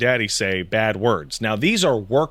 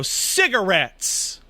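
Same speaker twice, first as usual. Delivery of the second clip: put-on defiant toddler; 7 semitones higher, much slower than usual. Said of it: "look you in the eye and go".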